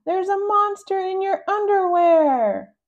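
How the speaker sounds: high, loud and slow alarm; playful child's panicked yell; shouted warning. Acted. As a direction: yell it to scare your siblings. Rascal warning siblings of monsters.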